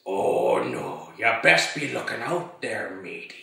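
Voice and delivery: rough, growled pirate talk. Retold or imitated. Man on the boat at Pirates of the Caribbean, pirate voice. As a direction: put on a growly old pirate. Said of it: "doing my pirate voice".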